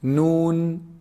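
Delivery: "Noon" held long, ringing in the nose; nasal noon. Shown as a demonstration. Teacher modelling the ghunna sound, long nasal "Noon". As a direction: hum out the n in "Noon" from the tongue tip. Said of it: "There's nasal sound".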